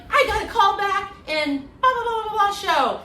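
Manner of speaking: high, loud excited boast; a touch of a gushing student; bragging excitedly. Retold or imitated. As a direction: gush about your callback. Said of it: "They'd be like".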